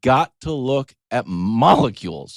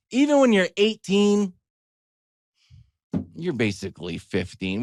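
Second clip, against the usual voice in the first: ordinary delivery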